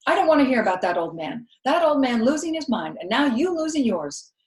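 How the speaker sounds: loud, fast exasperated rant; exasperated scold. A narrator's reading. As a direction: cut her off, fed up. Viola scolding about granddaddy.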